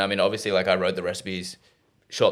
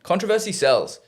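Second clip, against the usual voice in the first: high, hammed-up repeat of controversy sells; a sing-song high echo; mock echo; 10 semitones higher than usual. Echoing as a mock voice.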